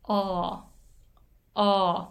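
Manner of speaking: "O" held open and steady, mouth not moving; demo vowel of ball. Shown as a demonstration. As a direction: say "O" as an open aw with no glide. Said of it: "口動かない". (「O」を口を動かさず開いたまま)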